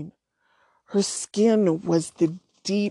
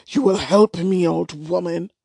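rough, louder demand; 4 dB louder than usual. A narrator's reading. Jumbie demands the witch's help, rough and threatening. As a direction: push the words out rough and menacing. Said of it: "Her voice became rough".

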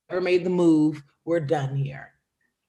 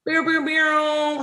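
mock intro fanfare; 10 semitones higher, slower than usual. Intro sound effect.

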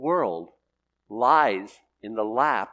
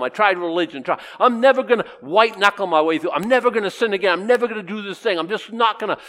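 loud, raised-pitch reenactment of struggling; worked-up mock resolve; desperate retold plea; 4 semitones higher, 6 dB louder than usual. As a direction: cry out for help, then pile up vows. Reenacts his struggle before salvation.

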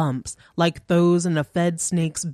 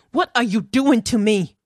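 higher and louder, panicked; terrified cry; 6 semitones higher, 5 dB louder than usual. A narrator's reading. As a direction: cry out in fear. Natalie trapped by the shadow.

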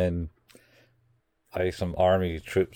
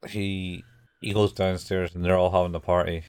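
usual speaking voice

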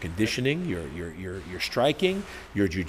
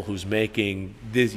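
ordinary delivery

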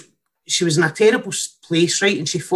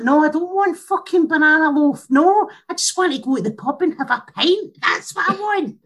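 high-pitched mock tantrum; comically fed-up rant, pitched way up; exasperated outburst; 10 semitones higher than usual. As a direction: refuse it in a rising, indignant whine. Acted outburst refusing banana loaf.

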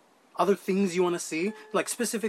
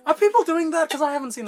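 shocked; 10 semitones higher, 6 dB louder than usual. Real, not acted. Shocked at bat-eating videos.

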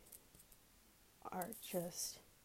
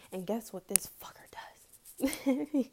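conspiratorial whisper; 3 semitones higher than usual. Real, not acted. Conspiratorial aside about leader.

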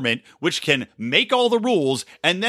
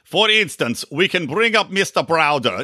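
put-on half-serious stab at a Russian accent. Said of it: "should I do a Russian accent?".

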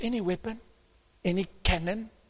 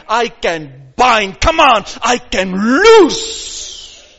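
slow, loud declaring; preaching at full force; 5 semitones higher, 14 dB louder, much slower than usual. Real, not acted. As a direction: proclaim each word with power.

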